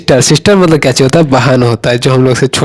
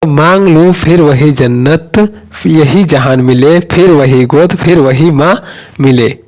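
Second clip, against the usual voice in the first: recited couplet; 2 semitones higher, slower than usual. Shown as a demonstration. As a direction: recite the couplet slowly, drawing out the words.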